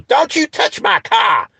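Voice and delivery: very high, drawn-out mock screech; mocking high-pitched shriek; shrieking mockery. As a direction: imitate her screeching in a shrill, slowed-down voice. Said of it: "she goes off".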